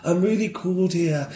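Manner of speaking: put-on a light Yorkshire accent, slow and dopey; lazy Yorkshire drawl for a bored hero. Mocking Jon Snow in a light Yorkshire accent. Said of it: "I can do the accent really well".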